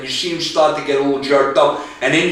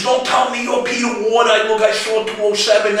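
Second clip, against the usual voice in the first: high, shouted accusation; disbelieving shout; 7 semitones higher than usual. Retold or imitated. Daniel Craig shouting at the big guy, disbelieving. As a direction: refuse to believe him.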